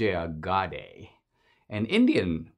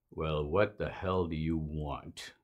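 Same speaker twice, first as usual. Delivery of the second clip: low, soft, slow hostile voice; a suspicious Klansman at the door, menacing and quiet; man behind the door sizing up a stranger, low and slow; mock Klan leader; 6 semitones lower, 9 dB quieter than usual. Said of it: "imaginative rendition".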